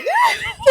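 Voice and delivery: high, loud burst of helpless laughter; loud laughing fit. Real, not acted. Sister cracking up at the misunderstood joke, loud laughter. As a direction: shriek with real laughter.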